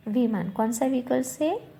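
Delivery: ordinary talk, nothing marked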